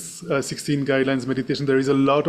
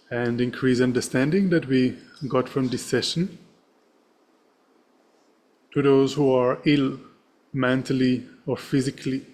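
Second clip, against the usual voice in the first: serene. Acted. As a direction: read the dedication gently with long pauses. Leads a dedication prayer.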